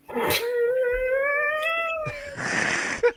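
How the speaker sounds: a high, long burst of helpless laughter; high laughing fit. Real, not acted. Losing his train of thought mid-podcast, he bursts into high laughter.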